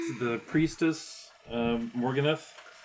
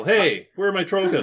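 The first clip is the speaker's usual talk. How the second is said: high, loud call down a tunnel; raised-voice demonstration of a yell; 8 semitones higher, 7 dB louder than usual. Acted. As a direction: holler it to someone far off. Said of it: "you yell back down the tunnel".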